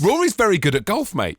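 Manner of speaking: high, loud sarcastic declaration; mock stating the obvious. Teasing his co-host with a loud sarcastic obvious fact. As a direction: state it like huge news, mate.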